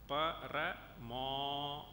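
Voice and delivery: "Parama" stretched very slowly syllable by syllable; very slow demo. Shown as a demonstration. Teacher counting beats for students, very slow. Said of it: "Suppose I were to say".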